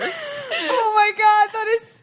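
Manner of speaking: high, slow, laughing disbelief; cracking up in disbelief; laughing shriek. Real, not acted. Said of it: "funniest thing I've ever heard".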